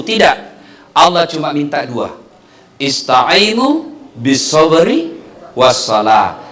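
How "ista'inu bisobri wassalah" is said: slow, lower, recited Arabic; Quran recitation. Acted. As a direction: recite the verse slowly and reverently. Quoting Quran verse. (lantunan ayat pelan)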